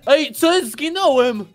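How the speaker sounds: high, loud startled complaint; indignant surprise. Real, not acted. Friend killed by a Christmas present, startled yelling. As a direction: exclaim in shocked protest.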